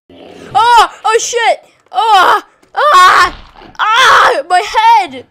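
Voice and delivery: high, very loud cries under attack; pained shouting. Real, not acted. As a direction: yell out as the blows land. Player being attacked in game.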